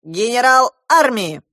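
loud, high proclamation; self-important general yelling; shouting general. A narrator's reading. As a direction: bellow your rank pompously. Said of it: "закричал он на меня".

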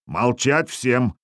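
usual speaking voice

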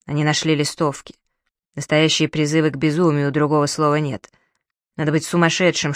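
usual speaking voice

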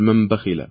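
"من بخل" said as "مم بخل" with a nasal m; nun turned to mim, iqlab. Shown as a demonstration. Quiz host repeating the nun-to-mim option to the caller. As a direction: turn the n of "من" into a humming m in "من بخل". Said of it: "النون ميما فنقول".

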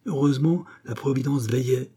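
ordinary delivery

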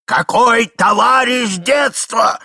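громкий возмущённый протяжный выкрик; возмутись громко, растягивая слова; крик старика. A narrator's reading. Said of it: "кричал старик". (гневно, высоко)

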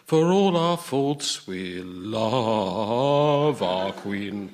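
very slow, drawn-out pirates singing their love for the Queen; hammed-up pirate chorus, dragged out. Guest retelling the Pirates of Penzance, slow mock pirate chorus. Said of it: "bloodthirsty Pirates of Penzance say".

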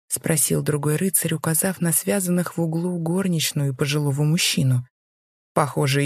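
usual speaking voice

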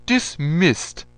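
loud, high dismissal; barked order. A narrator's reading. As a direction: snap the order out like a general. General dismissing the lieutenant.

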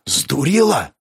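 ordinary delivery, nothing marked

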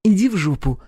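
high-pitched, irritated female retort; snapping back. A narrator's reading. Girl snapping back at his reproach, irritated. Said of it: "огрызнулась она".

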